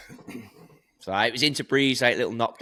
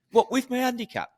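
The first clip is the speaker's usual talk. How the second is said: put-on much higher, indignant boy's voice; kid protesting; 13 semitones higher than usual. Son objecting to breaking eighty, high indignant protest. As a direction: squeak it like an outraged kid.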